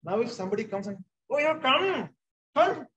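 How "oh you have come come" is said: high-pitched, exaggerated sarcastic greeting; sarcastic welcome. Shown as a demonstration. Host to an unwelcome visitor so the back row catches on, sarcastic welcome. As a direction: overdo the welcome, voice swooping way up. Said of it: "That's voice modulation".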